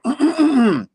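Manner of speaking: loud, high put-on throat clear; acted throat-clearing. Chicken Man breaking off his crow, exaggerated throat-clearing. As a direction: clear the throat squeakily, in character.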